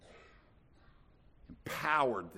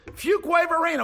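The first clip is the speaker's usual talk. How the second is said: Fuquay-Varina called out much higher and louder; exclaimed answer; 8 dB louder than usual. Retold or imitated. Preacher to congregation, Fuquay-Varina exclaimed high. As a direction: cry out Fuquay-Varina as the farthest place. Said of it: "you go to Israel and say".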